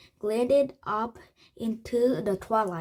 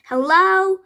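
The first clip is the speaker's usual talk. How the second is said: loud, high hello shout; 9 semitones higher, 10 dB louder than usual. A narrator's reading. Teddy shouting hello at the empty castle. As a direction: call out to the silent castle. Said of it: "Teddy shouted".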